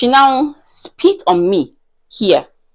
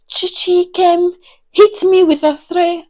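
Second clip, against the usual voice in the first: high-pitched, plaintive tattling voice; mock complaining; 8 semitones higher than usual. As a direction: imitate her wronged accusation. Imitates the woman's tearful accusation.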